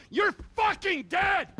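screaming the threat; furious shout. Acted. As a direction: explode on the repeat. Second threat escalates.

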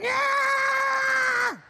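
very high, loud squealing yell; a high, loud dummy cheer; ventriloquist dummy voice. Acted. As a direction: shriek it like a squeaky puppet. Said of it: "no, I do the voice".

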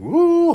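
loud high-pitched woo shout; high hype woo. Real, not acted. Hyped by the video, shouting a loud woo. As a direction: let out a hype yelp at the video.

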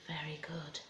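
soft whispered praise. Real, not acted. Teacher quietly praising the children after singing. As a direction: whisper it to settle the room.

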